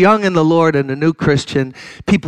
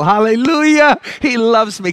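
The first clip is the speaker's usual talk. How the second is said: joyful worshipper; 8 semitones higher than usual. Retold or imitated. Worshipper reacting to God's love.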